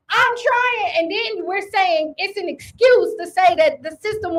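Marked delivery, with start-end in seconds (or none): 0.1-0.9 pitched-up defensive plea; high whiny protest. Retold or imitated. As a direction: squeak out a weak excuse. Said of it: "And he like".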